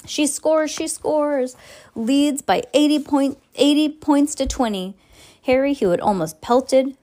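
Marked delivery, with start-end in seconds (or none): 0.1-4.9 high, excited goal call; excited commentator. A narrator's reading.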